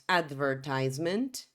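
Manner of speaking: American English "advertisement", stressed on "tise"; American pronunciation. Shown as a demonstration. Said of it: "No inglês americano, diríamos".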